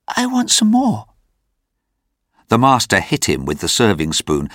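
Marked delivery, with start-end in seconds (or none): 0.1-1.1 put-on very high little-boy plea; frightened hungry boy. A narrator's reading. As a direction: plead timidly in a high child's voice. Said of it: "replied Oliver".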